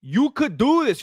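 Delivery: hammed-up cornerman's encouragement, pitch swooping on "do"; imitating a coach's insistent pep talk; mock corner pep talk. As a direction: cheer on a losing fighter unconvincingly. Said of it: "They're telling him".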